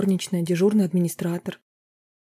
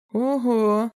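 higher whistle-like ogo; sly amazed exclamation; mock surprise; 5 semitones higher than usual. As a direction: feign being impressed. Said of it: "присвистнул Роберт".

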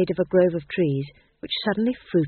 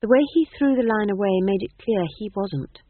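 plain reading voice

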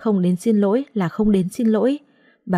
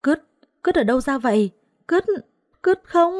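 shrill-pitched shriek, much higher in pitch; shrieking in disgust; 8 semitones higher than usual. Acted. Thùy shrieks at the chicken dung on her, high disgusted scream. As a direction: squeal it out as the dung hits. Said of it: "ả ta hét toáng lên".

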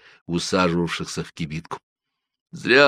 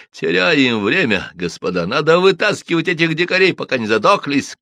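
urgent call; 8 semitones higher, 5 dB louder than usual. A narrator's reading. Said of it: "с болью воскликнул".

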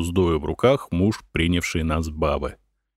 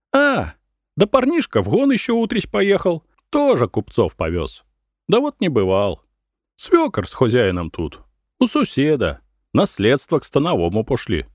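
high-pitched village woman's voice; chatty peasant woman; 7 semitones higher than usual. A narrator's reading. Peasant woman telling merchants where her menfolk went, chatty and high. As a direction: explain it in a raised, lively peasant voice.

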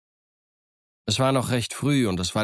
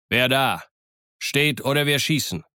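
higher-pitched sentry's challenge; challenging call; 4 semitones higher than usual. A narrator's reading. Captain challenges the approaching pirates, calling out. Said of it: "Dann rief er die Piraten an".

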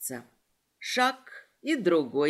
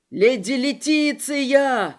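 loud, high, drawn-out call; 4 semitones higher, 6 dB louder, slower than usual. A narrator's reading. Sigurd runs after the carriage, calling out. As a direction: call urgently after someone leaving.

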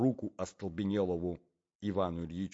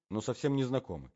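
ordinary delivery

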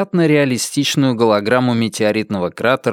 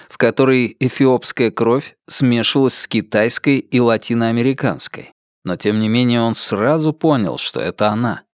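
ordinary delivery

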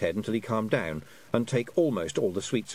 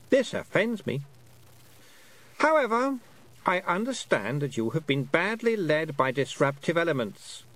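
high queenly voice, grandly forgiving; offended, condescending Queen; 8 semitones higher than usual. A narrator's reading. Queen blaming the girl's bad company, high condescending voice. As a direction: pardon her from on high. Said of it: "said the Queen".

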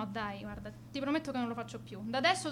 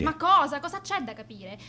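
much louder, sharp exasperated burst; exasperated snap; 3 semitones higher, 8 dB louder than usual. Acted. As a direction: bite back at the question. Annoyed girl snaps at interviewer.

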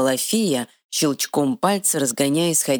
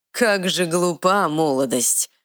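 ordinary delivery

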